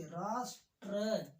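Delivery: राष्ट्र said slowly with a clear र after the ट; slow correct pronunciation. Shown as a demonstration. Teacher modelling राष्ट्र slowly for the class. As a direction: draw out राष्ट्र carefully, every consonant clear. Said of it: "उच्चारण पर विशेष ध्यान दें".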